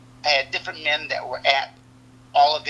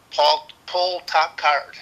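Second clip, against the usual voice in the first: slow coach command; much slower than usual. Retold or imitated. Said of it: "I go".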